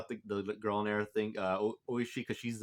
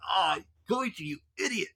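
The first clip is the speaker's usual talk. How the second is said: high mock scolding breaking into laughter; amused exasperation; 8 semitones higher than usual. Viewer scolding Koichi for his blunder, exasperated. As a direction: groan it high, then start laughing.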